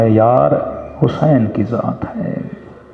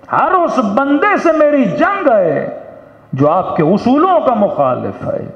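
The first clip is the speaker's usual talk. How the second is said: impassioned vow; 11 semitones higher than usual. Acted. Preacher declaring war on Maula's opponents, impassioned vow. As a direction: stretch it out high like a battle cry.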